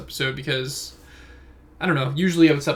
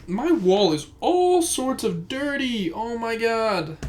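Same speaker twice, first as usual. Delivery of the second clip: high, slow, drawn-out dismay; dismayed surprise; 8 semitones higher, much slower than usual. Real, not acted. Noticing his dirty wall on camera, slow high dismay. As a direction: drag it out in horror at the wall.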